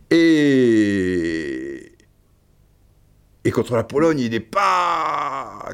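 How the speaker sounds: talking through a sneeze; sneezing. Real, not acted. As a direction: sneeze breaking into the words. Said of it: "Je vais éternuer".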